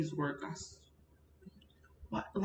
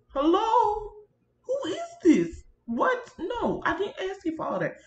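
high, loud mock outrage; mock indignation; 13 semitones higher, 5 dB louder than usual. Vlogger scolding unwanted pop-ups on screen, mock indignant. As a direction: squawk at the intruder in hammed-up disbelief.

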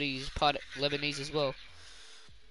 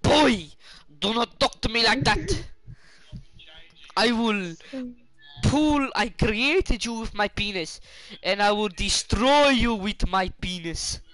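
loud, high, slow hammed-up Super Wog dad accent; 5 semitones higher, 6 dB louder, slower than usual. Doing a Super Wog dad voice for his friend, loud and hammed-up. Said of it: "Super Wog dad accent".

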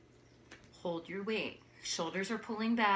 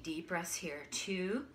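whispering; 3 semitones lower, 6 dB quieter, slower than usual. Real, not acted. Yoga teacher cueing breath quietly.

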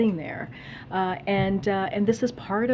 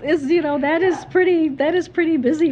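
higher and louder, pressing the point hard; emphatic insistence; 9 semitones higher, 10 dB louder than usual. Real, not acted. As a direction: hammer home how busy he is. Defending president's busy schedule emphatically.

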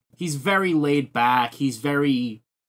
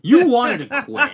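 higher, louder, amused insistence; emphatic repeat; 10 semitones higher, 8 dB louder than usual. Real, not acted. Telling a co-host they nearly quit, loud amused emphasis.

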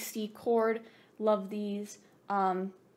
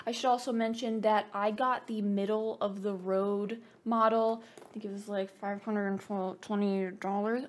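ordinary talk, nothing marked

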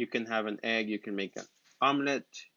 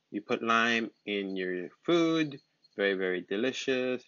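normal talking voice

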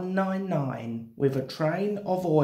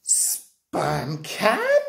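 outraged, incredulous echo; affronted character voice, rising to a squawk; fuming. A narrator's reading. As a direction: splutter it in offended disbelief. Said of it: "fumed one nine nine".

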